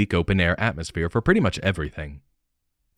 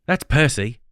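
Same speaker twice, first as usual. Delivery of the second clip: put-on Australian accent; 6 semitones higher than usual. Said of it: "also in an Australian accent".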